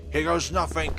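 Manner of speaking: loud, high shout before a leap; all-or-nothing exclamation; bold battle cry. Acted. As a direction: yell it as you go for it. Leaps to escape the fog.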